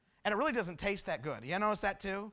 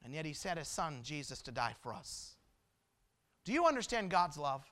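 quiet, lower, with pauses; soft preaching appeal; 4 semitones lower, 6 dB quieter than usual. Real, not acted. Preacher asks congregation about God's love, softly appealing. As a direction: drop to a gentle, searching question. Hushed appeal after climax.

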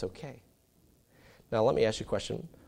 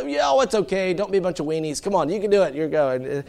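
dad coaxing; 8 semitones higher, 7 dB louder than usual. Retold or imitated. Dad teasing kids.

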